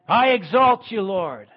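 I exalt you, called out loud and high; loud praise. Acted. Preacher lifting praise to God, loud and high. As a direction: cry it out to the Lord.

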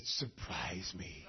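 low, slow, soft request; hushed, low retold prayer; little prayer. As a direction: murmur a small prayer, drawn out. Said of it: "I just prayed a little prayer".

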